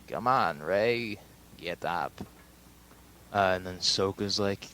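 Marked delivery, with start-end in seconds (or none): 0.1-2.1 lightly voiced older mentor, dipping low; a hint of a mentor's urging, half-hearted imitation; Obi-Wan. Old master urging Rey to get up, lightly imitated. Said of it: "Obi-Wan's like".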